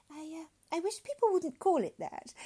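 high, hesitant objection; hesitant woman. Acted. Woman objects to doctor's slang, hesitant.

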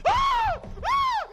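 shrieked scream, very high and loud; drunk's terrified scream. Retold or imitated. Drunk looks into the coffin, screaming in fright. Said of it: "everybody went".

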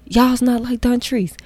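lightly voiced scolding teacher; mock a teacher's reproach; a hint of a scolding teacher. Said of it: "all the teachers just".

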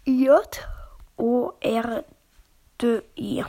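"J O R D I" Buchstabe für Buchstabe, laut und deutlich; buchstabiert. Shown as a demonstration. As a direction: jeden Buchstaben von "J O R D I" einzeln und deutlich. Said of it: "Also ich buchstabiere es euch". ("J O R D I" langsam, Buchstabe für Buchstabe)

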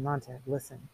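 low, very soft voice; hushed urging. Acted. Faber secretly advising Montag through the earpiece, hushed. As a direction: murmur secretly into his ear.

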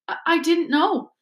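tearful, small excuse; sobbing. Acted. Ginny excusing herself to her father, sobbing. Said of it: "sobbed Ginny".

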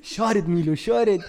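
high, loud urgent shushing. Retold or imitated. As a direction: hush him hard, he's too loud. Hushing drunk friend in cinema.

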